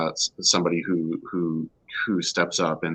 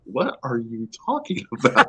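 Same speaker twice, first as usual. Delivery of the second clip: higher-pitched puzzled retort breaking into laughter; imitated bafflement; 6 semitones higher, 4 dB louder than usual. Husband voicing his wife's reply, mock-baffled. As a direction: play the baffled listener, amused.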